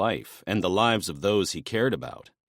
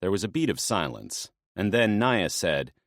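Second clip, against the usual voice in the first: ordinary delivery, nothing marked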